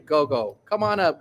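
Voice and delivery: ordinary delivery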